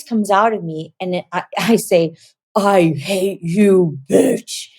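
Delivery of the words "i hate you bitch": mean, very slow demon imitation; guttural demon voice. Woman retelling a voice that came out of her, guttural demon. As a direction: draw it out like a guttural, hateful demon. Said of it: "guttural, mean, horrible voice".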